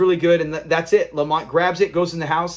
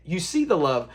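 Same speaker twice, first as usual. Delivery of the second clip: ordinary delivery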